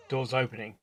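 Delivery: usual speaking voice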